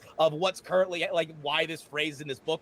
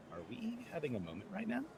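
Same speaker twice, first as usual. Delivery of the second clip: hushed Merle; 3 semitones lower, 13 dB quieter, faster than usual. Acted.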